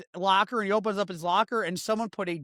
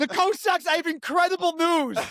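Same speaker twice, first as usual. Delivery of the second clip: mock excited announcement; 10 semitones higher, 4 dB louder than usual. Continues mock messenger bit.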